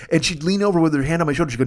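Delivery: ordinary talk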